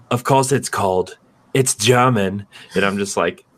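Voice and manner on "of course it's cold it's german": higher-pitched mock German accent. German lady in a fancy ice club, mock German accent. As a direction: imitate a very German lady's accent. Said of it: "very German lady is like".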